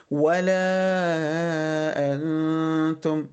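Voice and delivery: very long ولا, then a hummed n in أنتم; madd and ikhfa. Shown as a demonstration. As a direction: slowly stretch ولا and hum into أنتم. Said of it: "مد منفصل تاني وإخفاء أهو". (مدّ ولا وإخفاء نون أنتم)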